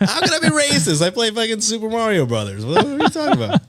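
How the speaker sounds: very high, laughing mock outrage; mock indignant protest. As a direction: squeal the protest in hammed-up disbelief. Mock racist's defense, escalating joke.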